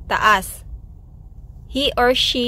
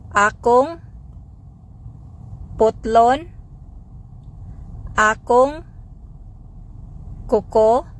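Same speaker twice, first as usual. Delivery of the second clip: slow, each word said alone with a pause. Shown as a demonstration. Teacher spacing out each word for learners, word by word. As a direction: break the phrase into single words for learners. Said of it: "Sample phrases".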